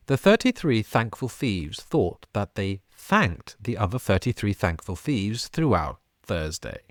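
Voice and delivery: thirty-three thankful thieves with every th lisped hard; exaggerated th practice. Shown as a demonstration. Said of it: "First time, here we go".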